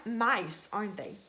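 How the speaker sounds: usual speaking voice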